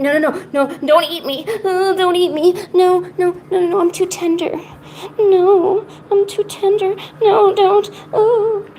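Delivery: high panicky pleading, begging not to be eaten; mock terror, squealing no no no; frightened prey animal. Pretending cat will eat her.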